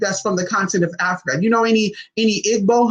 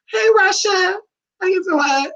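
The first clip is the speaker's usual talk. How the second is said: very high, coy come-on; flirty greeting; 13 semitones higher, slower than usual. Pretend. Flirting with imagined Russians.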